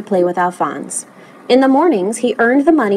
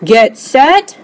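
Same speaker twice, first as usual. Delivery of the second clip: louder, higher starting call; coach's rising command; bellowing; 4 semitones higher, 5 dB louder than usual. A narrator's reading. Coach readies the swimmers, bellowing. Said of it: "bellowed the coach".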